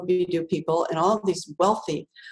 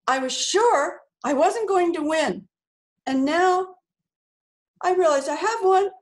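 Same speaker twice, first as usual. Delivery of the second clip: overwhelmed acceptance speech; 8 semitones higher than usual. Retold or imitated. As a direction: relive the tearful thank-you on stage, pitched high. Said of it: "All I said was".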